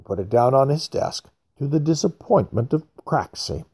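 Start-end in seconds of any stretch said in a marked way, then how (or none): none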